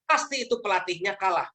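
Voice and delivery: normal talking voice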